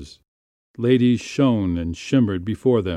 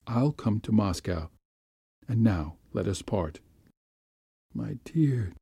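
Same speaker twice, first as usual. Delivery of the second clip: soft, subdued promise and parting; quiet, intimate near-whisper; hushed farewell; 6 dB quieter than usual. A narrator's reading. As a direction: say goodbye tenderly under your breath. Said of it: "went on in a whisper".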